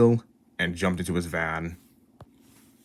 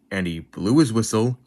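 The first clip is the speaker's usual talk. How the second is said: usual speaking voice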